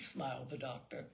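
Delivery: plain reading voice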